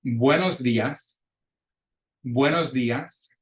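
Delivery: buenos días slowly in clear Spanish; model pronunciation. Shown as a demonstration. Teacher pronouncing words for students to repeat, buenos días as a slow model. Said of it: "gonna pronounce those words for you".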